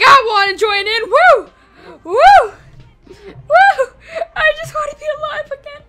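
loud, high hammed-up wail with whoops; mock wailing. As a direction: play a ghost crying out to join in. Voices dead Megan wailing to join.

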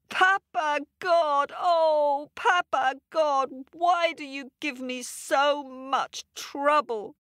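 high, pleading cry to the sky; woman crying out. A narrator's reading. Woman crying up to Papa God. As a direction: plead with God over the spilled honey.

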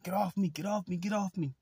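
high-pitched panicked get off me; frantic begging, pitch shot way up; panicked plea. Retold or imitated. A pinned boy begging the woman to get off, panicked and high.

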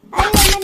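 loud sudden cry of pain; a sharp loud ouch when hit; pained yelp. Acted. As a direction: yelp as if slapped. Wife struck awake.

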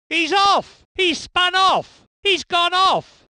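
high, urgent race-call of a spin; excited call. Acted. Race commentator calling a spin-off to viewers, excited and high.

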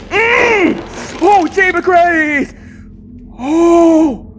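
high, loud, drawn-out dread; frightened wail. Real, not acted. Player refusing to face the trauma, frightened wail. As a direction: wail it out in alarm. Scared by game enemy.

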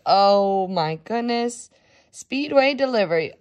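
ordinary delivery, nothing marked

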